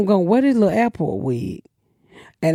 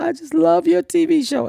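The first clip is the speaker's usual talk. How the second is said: a higher, sweet fan voice; mock gushing fan; 9 semitones higher than usual. Fan at the awards gushing over the TV show, higher. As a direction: gush like a starstruck fan. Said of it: "She's like".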